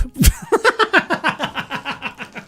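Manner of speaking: high giggling laugh. Real, not acted. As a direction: burst into a high laugh at the pun. Laughing at own joke.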